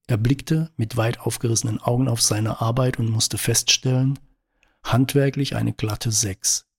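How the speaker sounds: ordinary delivery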